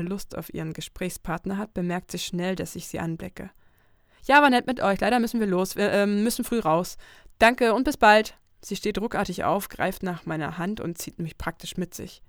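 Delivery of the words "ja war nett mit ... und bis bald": loud, bright, fake-friendly goodbye, slowing at the end; Lucy, brushing them off. Lucy brushes off two pushy men at the bar with loud fake cheer. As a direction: cut the conversation short with a big smile.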